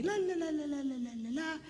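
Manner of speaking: nonsense babble starting high and falling; mock chattering; imitating wife's chatter. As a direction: mimic endless chatter in la-las. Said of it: "she's talking to me".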